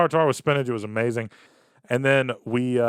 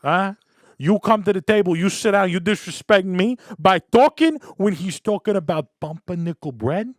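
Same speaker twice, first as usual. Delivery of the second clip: louder, higher mock Italian-American father; Italian dad; 5 semitones higher, 5 dB louder than usual. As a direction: scold the date like an old-school Italian dad. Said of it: "is this how Italian men".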